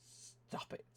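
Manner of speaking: whispered telling-off to himself; a soft whispered stop it. Real, not acted. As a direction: hush yourself in a whisper.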